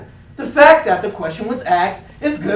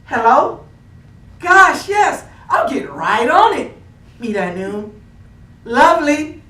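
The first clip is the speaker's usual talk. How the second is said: customer service voice; 3 semitones higher, 4 dB louder, slower than usual. Shown as a demonstration. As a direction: switch into a chirpy customer-service phone voice. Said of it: "I put on my phone voice".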